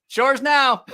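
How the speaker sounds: high, loud teasing call; a teasing yell from across the show floor; mock shout. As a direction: call it out gleefully across the hall. [name] teasing from afar.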